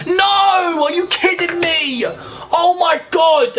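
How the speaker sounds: real rage at losing the match; screaming in disbelief at the screen; furious outburst. Real, not acted. Losing the semi-final, rage outburst.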